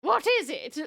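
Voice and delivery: high, loud royal demand; grand mock-regal question; playful queen. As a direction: ask like an eager queen. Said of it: "a meal for the queen".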